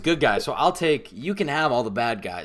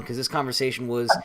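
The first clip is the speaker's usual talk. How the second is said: usual speaking voice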